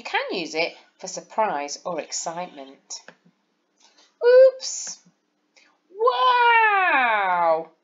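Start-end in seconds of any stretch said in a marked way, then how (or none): 4.2-5.0 much higher, louder yelp of surprise; surprised oops. Shown as a demonstration. Teacher shows surprise, high and loud. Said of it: "my voice and the exclamation mark make them sound exciting". 5.9-7.7 much higher, shouted wow; amazed, call it out; excited wow. Shown as a demonstration. Teacher shows excitement, high shouted. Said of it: "my voice and the exclamation mark make them sound exciting".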